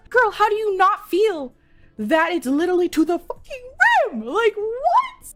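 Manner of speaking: high-pitched incredulous imitation yell; retold screaming. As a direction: squeal in hammed-up disbelief. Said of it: "running out screaming at her".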